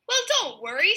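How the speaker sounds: high-pitched mock shout. A narrator's reading. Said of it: "Strong Arm shouted".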